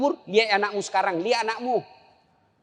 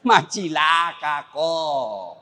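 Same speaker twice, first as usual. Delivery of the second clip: slow stern verdict; 4 semitones lower, much slower than usual. Acted.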